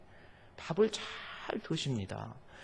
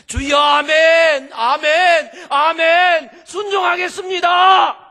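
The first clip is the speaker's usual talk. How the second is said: slow, loud, much higher cries of amen; shouted prayer; 12 semitones higher, 17 dB louder, much slower than usual. Acted. Believer crying out to the Lord in surrender, shouting. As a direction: cry out in fervent surrender.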